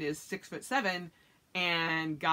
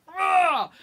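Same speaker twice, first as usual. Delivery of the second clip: high, loud playful yell of the husband; mock yell; 11 semitones higher, 10 dB louder than usual. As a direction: shout out as he drags her along. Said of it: "So he's like".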